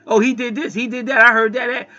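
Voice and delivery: a sneering imitation of people spreading rumors; mocking gossip. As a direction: parrot the gossipers with scorn. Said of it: "all y'all wanna talk about is gossip shit".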